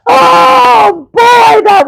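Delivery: delighted shout. Real, not acted. Host cracking up at own bit.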